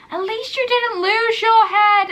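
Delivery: high, loud, drawn-out mock complaint; Jennifer. Imitating an actress sparring with another queen, high and loud. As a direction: throw it out loudly, stretched and high. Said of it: "Jennifer going".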